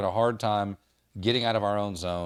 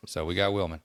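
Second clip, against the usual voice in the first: ordinary talk, nothing marked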